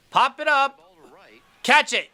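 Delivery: will the ball into the glove; urging shout. Real, not acted.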